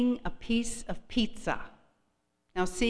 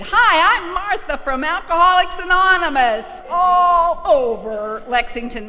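much higher, loud chirpy greeting; brassy Martha; 10 semitones higher, 9 dB louder than usual. Retold or imitated. Martha greeting her in the square, high and loud. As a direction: sing out the cheery hello. Voicing Martha introducing herself.

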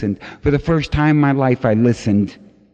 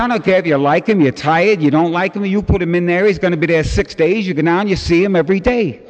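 louder and higher, firm scolding; scolding sponsor; 6 semitones higher, 4 dB louder than usual. Retold or imitated. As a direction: lay down the law to him.

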